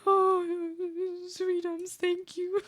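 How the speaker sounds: put-on high, squeaky baby-talk gush; doting cutesy voice. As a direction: coo over them like a sweet pet. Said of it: "I'm like".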